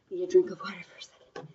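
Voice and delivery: whisper, quick and breathless; a fast whispered aside. Real, not acted. Aside while catching breath.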